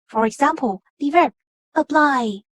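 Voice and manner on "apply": "apply" with a weak a and strong PLY; second-syllable stress. Shown as a demonstration. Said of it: "then the second syllable is stressed".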